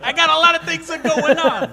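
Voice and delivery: laughing through the words. Real, not acted. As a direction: giggly protest while laughing. Laughing at the friend's teasing.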